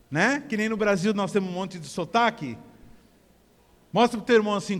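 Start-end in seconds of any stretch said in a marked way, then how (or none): none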